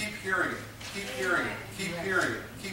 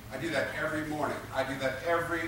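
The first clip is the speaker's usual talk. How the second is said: ordinary talk, nothing marked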